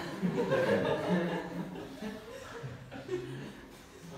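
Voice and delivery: laughter. Real, not acted. Laughs at exception joke.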